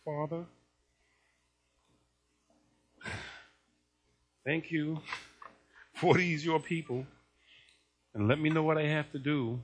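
low, very soft weary prayer; mock weeping prayer. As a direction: pray quietly, understated sorrow, barely holding patience. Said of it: "he's weeping".

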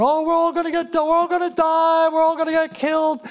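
high-pitched panicked wail, imitated; imitated panic, sustained high; mock wailing. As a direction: act out a soldier wailing that all is lost. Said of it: "he's wailing".

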